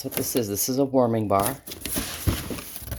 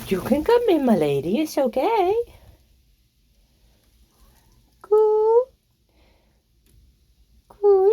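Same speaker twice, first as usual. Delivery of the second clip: high cooing to hens; 4 dB louder than usual. Real, not acted. Luring the hens into the coop, very high cooing voice.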